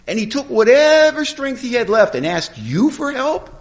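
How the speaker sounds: high, loud mock disbelief; incredulous questioning. Sponsor grilling his sponsee, incredulous. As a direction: build the case in a high, disbelieving voice.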